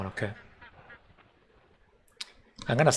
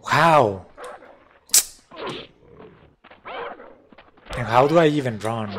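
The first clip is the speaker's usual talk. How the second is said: exasperated outburst; 6 dB louder than usual. Real, not acted. Frustrated with game controls.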